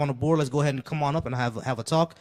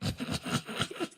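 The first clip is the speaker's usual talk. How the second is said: wheezy burst of laughter; real laugh at being pranked; 10 dB quieter than usual. Real, not acted. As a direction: crack up laughing. Reacts to a troll verse.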